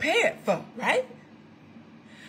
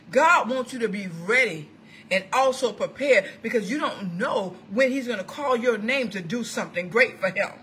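ordinary talk

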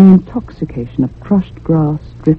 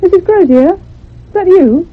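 loud, high, searching call across the garden; 11 semitones higher, 3 dB louder than usual. Acted. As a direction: shout for the housekeeper, unsure who is there. Calling for the housekeeper outside.

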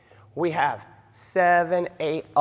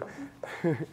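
short breathy laugh; 9 dB quieter than usual. Real, not acted. Laughs after botching the count.

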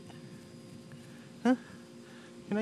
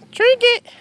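loud, much higher shout of a name; calling out; 11 dB louder than usual. Real, not acted. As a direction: call the dog across the yard. Calling dog on porch.

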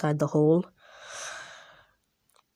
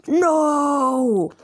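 character's cry; 9 semitones higher, 3 dB louder than usual. Acted. Defeated character cries out.

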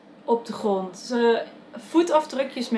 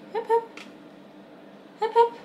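high, light calling to a pet; coaxing the dog; 11 semitones higher than usual. Real, not acted. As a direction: shoo the dog along sweetly. Talking to dog in front of camera. (hoog, lokkend roepen naar de hond)

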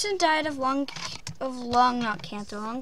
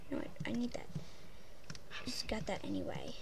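whisper; 3 semitones lower, 16 dB quieter, faster than usual. Real, not acted. Whispered aside.

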